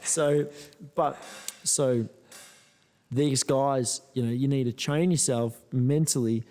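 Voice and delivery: ordinary talk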